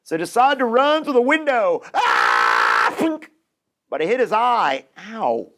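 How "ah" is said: deep charging roar; low charging yell. Acted. Wolf charging at the door, deep yell.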